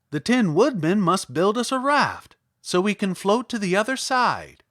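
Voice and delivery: a bright idea spoken with gusto; eager Scarecrow. A narrator's reading.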